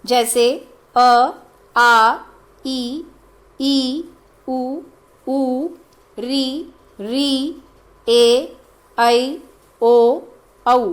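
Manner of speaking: each vowel said clearly on its own, with a short pause between; reciting the vowels. Shown as a demonstration. Teacher recites the thirteen vowels in order, slow and clear. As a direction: spell out the vowels one by one for students to memorise. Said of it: "आप इन्हें क्रम से याद करेंगे".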